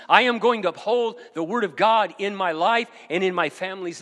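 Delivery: loud, high preaching at full force; bold declaration. Acted. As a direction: proclaim it loud to God.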